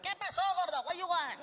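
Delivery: high-pitched mock rude vendor; mean taco vendor. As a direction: snap at the customer in a put-on voice. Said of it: "the guys were mean".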